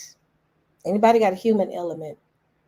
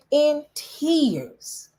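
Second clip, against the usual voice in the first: tearful; 9 semitones higher than usual. Real, not acted.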